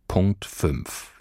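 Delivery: ordinary delivery, nothing marked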